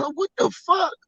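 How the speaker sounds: high, put-on startled yelp; mock startled dad. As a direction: squeak it out like a shocked old man. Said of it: "And said".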